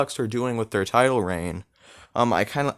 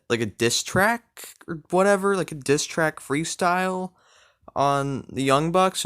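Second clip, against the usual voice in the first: ordinary talk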